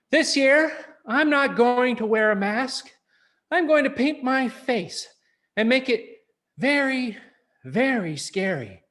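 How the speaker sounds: put-on a kid bursting with his plan; excited boy voice. Lance telling his father.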